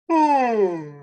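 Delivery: big, loud, high yawn. Real, not acted. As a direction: let a long yawn out. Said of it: "I don't know why I'm yawning so much".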